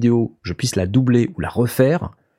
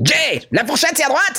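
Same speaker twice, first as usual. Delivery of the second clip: scolding nurse. Pretend. Scolding Jay at table.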